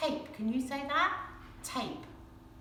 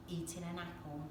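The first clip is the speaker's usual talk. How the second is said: ordinary delivery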